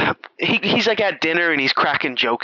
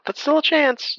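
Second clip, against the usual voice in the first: squealed high; 14 semitones higher than usual. Acted. Repeating the line, squealing high.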